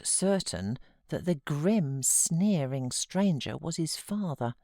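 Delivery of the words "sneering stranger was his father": ordinary delivery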